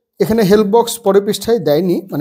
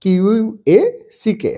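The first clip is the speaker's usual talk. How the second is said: spelling out letters; 2 semitones higher, much slower than usual. Shown as a demonstration. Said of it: "তার sound হচ্ছে quack".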